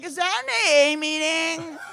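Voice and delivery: high, loud, drawn-out squeal; hammed-up drunk excitement; drunken delight. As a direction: call it out like a thrilled, oblivious drunk. Drunk spotting meeting, calling out.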